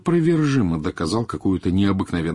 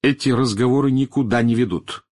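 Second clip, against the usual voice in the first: firm, raised reply; resolute; 5 semitones higher, 5 dB louder than usual. A narrator's reading. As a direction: shut the argument down decisively. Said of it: "решительно ответил Огден".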